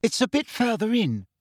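high-pitched call, only mildly loud; calling over shoulder. A narrator's reading. Hermione calling back to Umbridge in the forest, calling out. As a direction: call back to someone trailing behind. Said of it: "calling loudly over her shoulder".

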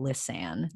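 "listen." said lis-SEN to rhyme; rhyming pronunciation. Shown as a demonstration. Showing her co-host how they said "listen." to rhyme. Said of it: "made it rhyme by saying".

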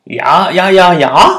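mild shout from inside; called out. A narrator's reading. Rabbit answering from inside the cave, calling out. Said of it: "ropte det innenfra i hulen".

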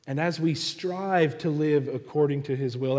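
normal talking voice

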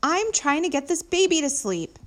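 loud, high-pitched complaint; exasperated yell. A narrator's reading. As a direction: shout it across at the neighbours. Neighbour yelling about the baby.

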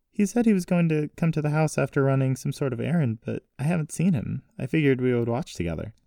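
put-on high girlish character voice; wondering granddaughter. Voices Jessie.

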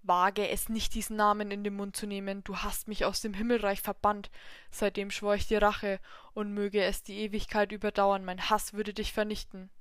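loud, furious devil voice; angry devil. Acted. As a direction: spit out the hatred. Devil rages at god.

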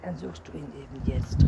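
mildly irate father giving an order; a touch of anger, understated; angry call. A narrator's reading. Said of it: "rief der Vater wütend". (leicht wütender Ausruf)

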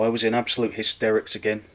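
normal talking voice

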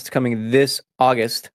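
ordinary talk

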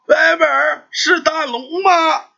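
put-on high, slow old mother's call; calling out to son. A narrator's reading. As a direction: draw it out, calling toward the door. Blind mother calls to door. (拉长声音高声唤儿)

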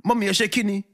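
hammed-up uncle scolding his wife; indignant uncle in Yoruba. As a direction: demand answers in mock outrage. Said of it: "voices uncle in Yoruba".

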